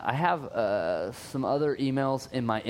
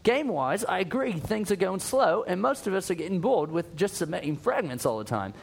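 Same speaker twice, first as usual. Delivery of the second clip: put-on a higher, amateur British accent that drifts toward Australian; attempted British accent; 6 semitones higher than usual. Reading a UK listener's email to the cohost in a wobbly British accent. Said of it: "if I got a British accent here".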